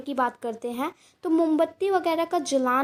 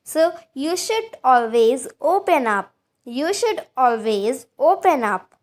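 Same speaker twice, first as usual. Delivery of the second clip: slow, careful teacher read of each word; slow model sentence; 4 dB louder, much slower than usual. Shown as a demonstration. Teacher reading an example to Hindi learners, slow and clear. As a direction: say it slowly so students can repeat. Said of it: "teacher models example slowly".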